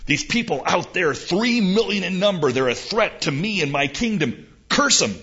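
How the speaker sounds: a king ordering a curse; lightly voiced king. King Balak demanding Balaam curse Israel, pushy and loud. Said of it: "Balak says".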